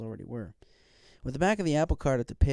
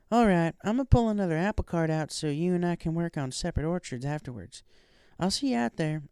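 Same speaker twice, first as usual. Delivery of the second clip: lightly voiced country mare, higher; a hint of a friendly farm pony, raised pitch; Applejack; 6 semitones higher, 3 dB louder than usual. A narrator's reading.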